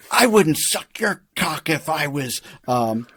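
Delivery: high, building to a shouted put-down; indignant Taffy retort. Retold or imitated. Quoting the movie, imitates Taffy's loud comeback to a creep. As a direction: spit the insult, rising to a yell. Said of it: "And she's like".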